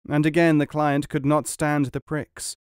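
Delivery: ordinary delivery, nothing marked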